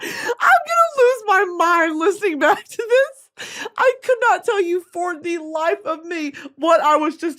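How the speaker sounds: high, loud wail of frustration; exasperated outburst. Real, not acted. Streamer lost the train of thought, exasperated wail. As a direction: cry out, pitch shooting up.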